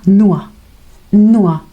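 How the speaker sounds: "Nua" with the r turned into a; nur as Nua. Shown as a demonstration. Teacher modelling German nur for students. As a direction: say "Nua", no r at all. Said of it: "sino como una A".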